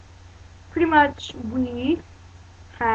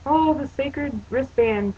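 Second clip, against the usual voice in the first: slow, high mock-awed voice; hammed-up reverent sigh; mock reverence; 5 semitones higher, slower than usual. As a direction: gush over it like a holy relic. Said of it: "they're all like".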